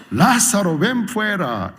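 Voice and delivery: calling out. Acted. Jesus calling Lazarus loudly.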